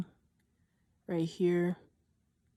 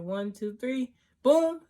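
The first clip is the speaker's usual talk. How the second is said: mock countdown; 5 semitones higher, 6 dB louder, slower than usual. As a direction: count calmly, then jump to a high, loud BOOM. Piercer counts down, pushes needle.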